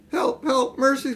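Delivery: high pleading cry for mercy, a touch breathless; faint cry. A narrator's reading. Witch begs the children not to leave her, faint cry. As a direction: beg weakly, as if life is draining out. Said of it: "cried the Queen, or the witch, in a faint voice".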